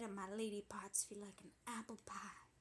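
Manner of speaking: soft unvoiced whisper. Telling the listener the naughty detail in a whisper. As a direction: confide it under the breath.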